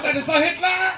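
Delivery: high, loud triumphant yell; mock German shout. German tourists at the border, loud mock shout. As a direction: bellow it over the border. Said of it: "every German hanging their arse over the border going".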